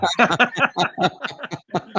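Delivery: bursting laugh, high; real self-mocking laugh. Real, not acted. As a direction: crack up at yourself. Laughs at calling himself dumbass.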